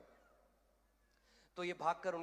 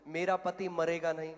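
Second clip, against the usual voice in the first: loud, firm declaration of faith; 8 dB louder than usual. Retold or imitated. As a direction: voice a coach modelling the words to repeat. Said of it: "बोल इसको".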